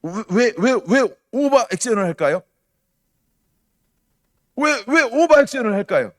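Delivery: high, loud, repeated why; overacted, insistent question; exaggerated questioning. Mimicking overacted outrage while asking.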